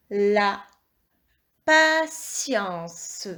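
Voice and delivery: "la patience" drawn out with pauses between syllables; slow dictation. Shown as a demonstration. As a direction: reread "la patience" slowly as la, pa, tien, ce. Teacher rereads word slowly for checking.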